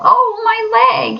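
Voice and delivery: high, slow pained cry. A narrator's reading. Mole cries out after tripping in snow, pained. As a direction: yelp from a hurt shin. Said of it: "he cried".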